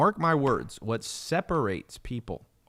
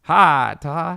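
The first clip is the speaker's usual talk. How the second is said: loud, higher country drawl; country-accented greeting, pitched up; 5 semitones higher, 8 dB louder than usual. Retold or imitated. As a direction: imitate a country neighbor's hello. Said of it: "he used to talk like this".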